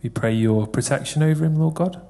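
ordinary talk, nothing marked

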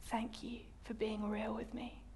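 very soft, breath-only whisper. Retold or imitated. God answering her after the tantrum, whispered. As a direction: lean close and whisper it tenderly. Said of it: "and whisper in".

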